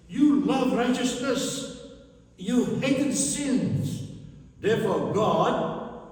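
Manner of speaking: loud, high preaching cry; fervent preacher declaring the verse; preaching proclamation. Acted. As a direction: proclaim it with full force. Preacher proclaiming God's words.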